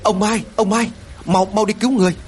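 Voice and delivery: high-pitched call for help; insistent, high-voiced summons; urgent calling out. A narrator's reading. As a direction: call out to raise the alarm. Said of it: "có tiếng gọi lớn".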